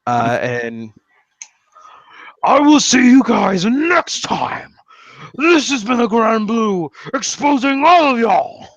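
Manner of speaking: slow, high, theatrical farewell; grand sign-off. Pretend.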